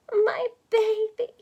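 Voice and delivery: high, slow, trembling mother's voice; quavering mother. Acted. Mother in a dream to her daughter, high and quavering. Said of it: "she said in a quavering voice".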